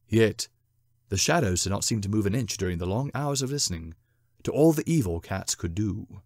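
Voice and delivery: ordinary delivery, nothing marked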